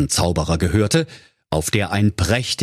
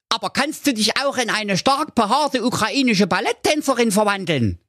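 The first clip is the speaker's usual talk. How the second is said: Doktor Schulz; 13 semitones higher than usual. Acted. Dog goads the wizard.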